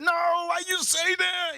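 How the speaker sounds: very high, drawn-out squeal; mock wailing protest. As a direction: imitate a friend wailing in protest, slowly. Acts out friend's alarmed protest.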